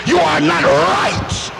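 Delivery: angry demand. Retold or imitated. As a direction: hammer each accusation out. Preacher voicing angry cheated lender.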